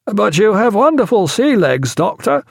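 a hint of an upbeat sea officer; lightly voiced shipmate.

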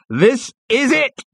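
high, loud, stretched-out words; booming compère's big reveal; climactic showman cry. Acted. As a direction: draw it out for a big stage climax.

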